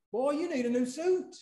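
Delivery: a hint of a scolding Southern mama; mother's scolding. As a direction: play mama telling her son off. Mother's repeated line again.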